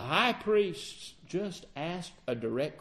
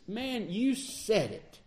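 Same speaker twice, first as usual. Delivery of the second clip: higher, slow, drawn-out "Man, you said it"; wholehearted sympathetic agreement; emphatic agreement; 4 semitones higher, 4 dB quieter, slower than usual. Shown as a demonstration. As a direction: lean into it, fully agreeing. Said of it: "agreeing emphatically with me?".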